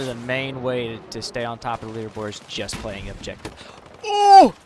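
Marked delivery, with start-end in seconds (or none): 4.0-4.5 sudden loud high exclamation; startled yelp at a surprise; surprised shout. Real, not acted. Sudden event in game.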